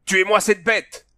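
high, loud, drawn-out roar. A narrator's reading. Baptiste orders his men to kill, roaring. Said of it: "rugit le Baptiste".

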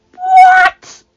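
huge high, loud shriek of shock. Acted. Joffrey hearing the Dragon Queen landed, shrieking. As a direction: shriek it in panic. King hears of rebellion.

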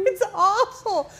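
high, stretched laughing wail; laughing too hard to speak; helpless laughter. Real, not acted. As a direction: wail it through tears of laughter. Cracking up over printing mistake.

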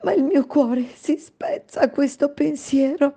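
high tearful maid's voice; sobbing. Acted. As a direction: let the heart break in the words. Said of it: "singhiozzò la donna".

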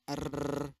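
"R" rolled out long; trilled R. Shown as a demonstration. Interpreter demonstrating the Indonesian R sound, long trilled "R". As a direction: hold a long rolling trill on "R". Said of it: "disebut oleh terapis sebagai trill".

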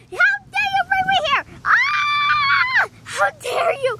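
high, shrieking mock fury; hammed-up indignant diva doll; outraged doll. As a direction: scream in pretend outrage. Doll Mariah furious at being brought.

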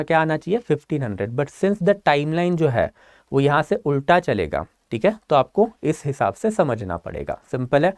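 ordinary talk

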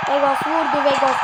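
normal talking voice

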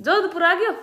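high-pitched surprised question; surprised echo. Real, not acted. Wife hearing they have reached Jodhpur, surprised. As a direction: repeat the news back, pitched right up. Echoes husband's news of arrival.

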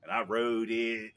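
normal talking voice

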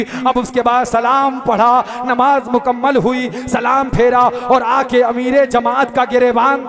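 loud, stretched, emphatic declamation; full-force preaching. Real, not acted. Preacher confronts the amir with his conclusion, declaiming. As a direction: build the argument to a ringing climax.

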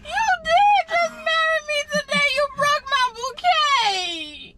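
high, drawn-out crying shout; slow, high bawling protest; tearful wailing. Real, not acted. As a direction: wail each word out like a tantrum. Wailing over broken bouquet, marriage denied.

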